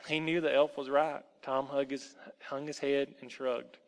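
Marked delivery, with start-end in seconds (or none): none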